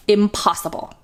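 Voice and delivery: usual speaking voice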